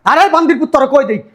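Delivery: high-pitched, indignant scolding; angry threat. Acted. Threatening insults, driving them away.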